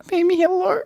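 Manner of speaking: very high cutesy greeting; baby-talk voice. Shown as a demonstration. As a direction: squeak it sweetly at a partner. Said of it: "Like, that, like".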